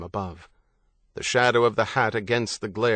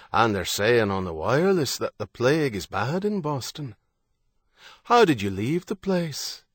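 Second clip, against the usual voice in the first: lilting Irish interrogator, pitch swinging upward; menacing Irish gunman. Acted. Gunman questioning John about plague in Boston, lilting menace. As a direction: probe with a musical Irish lilt.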